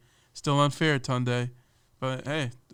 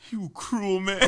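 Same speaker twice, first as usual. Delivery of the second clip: higher, hammed-up wounded accusation; mock outrage; 8 semitones higher, slower than usual. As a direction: play the betrayed victim for laughs.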